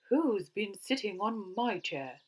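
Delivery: mildly cross bear voice; cross Mother Bear. A narrator's reading. Mother Bear asking about her chair, mildly cross. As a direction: ask it with a touch of crossness. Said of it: "in her cross voice".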